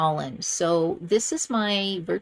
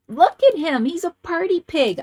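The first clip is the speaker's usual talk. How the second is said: thrilled; 8 semitones higher, 5 dB louder than usual. Real, not acted. Thrilled by favorite card.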